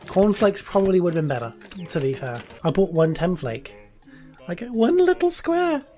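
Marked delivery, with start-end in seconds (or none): 4.5-5.8 much higher, tiny-voiced; high squeak. Complaining about the one little cereal square, very high.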